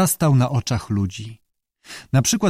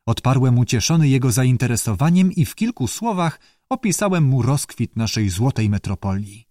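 ordinary talk

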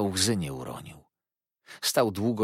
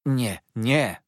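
higher, louder anguished cry; calling out; 6 semitones higher, 7 dB louder than usual. A narrator's reading. Woman crying out to stop the uncle leaving, loud plea. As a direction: fling it out as she rushes to the door. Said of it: "zawołała".

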